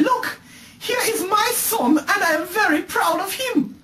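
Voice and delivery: mock English accent. Son mimicking his father's proud words, mock English accent. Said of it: "In your bumbleclad English accent".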